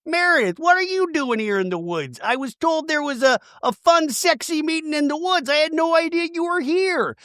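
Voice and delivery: high, coy, playful put-on voice; coy mock voice. Someone surprising Meredith in the woods, coy and high. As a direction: play it innocent and flirty, pitched high. Voiced figure in retold scene.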